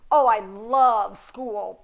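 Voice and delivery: slow, drawn-out mock love, then a low NOT; sarcastic. Shown as a demonstration. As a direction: gush sarcastically, puff, then drop a dry not. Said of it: "indicates sarcasm".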